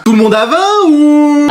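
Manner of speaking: high, slow, drawn-out cheer; gleeful cheering. Acted. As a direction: celebrate with a long whoop. Pupil cheers at supposed top marks.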